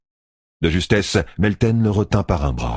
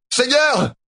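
much higher distant shout. A narrator's reading. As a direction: call out from afar. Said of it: "cria soudain une voix".

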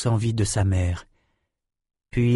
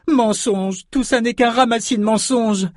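put-on high, indignant young girl; outraged denial; 12 semitones higher than usual. A narrator's reading. Samantha rejects Richard's story as lies, high outraged denial. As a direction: throw the claim back, pitched high.

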